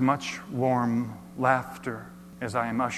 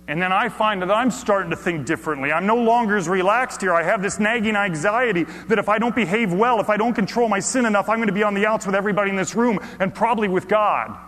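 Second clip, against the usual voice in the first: louder, pressing sermon voice; anxious preaching; 9 semitones higher, 8 dB louder than usual. Acted. Preacher voicing his nagging anxiety, urgent. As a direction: push through the worry with urgency.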